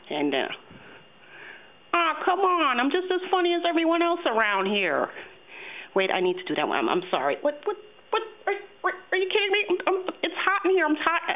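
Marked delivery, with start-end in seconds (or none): 1.9-5.1 puppet character voice. Acted. Puppet delivering a line for the take, high character voice. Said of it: "that's my voice". 7.4-11.3 high, fast puppet complaint; exasperated puppet. Acted. Puppet fed up with retakes, high exasperated voice.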